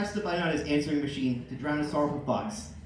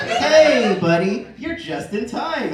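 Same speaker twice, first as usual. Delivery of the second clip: a loud, bright cheerful greeting; 7 semitones higher, 7 dB louder, slower than usual. Acted. Al greeting Tim, loud and cheerful. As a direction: hail him loud and cheerful. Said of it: "Al said cheerfully".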